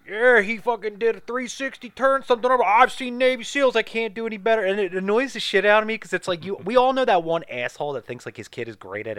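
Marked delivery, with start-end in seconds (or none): 0.0-4.6 high-pitched, over-impressed father; mock bragging dad. Dad boasting about his son's diving, mock bragging. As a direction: brag about your kid in a hammed-up voice.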